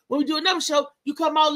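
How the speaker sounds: usual speaking voice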